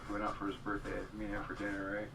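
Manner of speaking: normal talking voice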